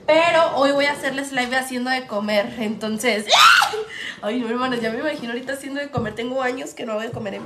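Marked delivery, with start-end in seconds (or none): 3.3-4.2 sudden shrieking laugh; loud high laugh. Real, not acted. Laughing at herself cooking on live, loud shriek.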